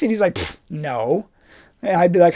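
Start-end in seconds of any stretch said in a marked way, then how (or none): 0.3-1.3 low, soft scoffing no; dismissive scoff. Retold or imitated. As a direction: brush him off. Joe mocks him.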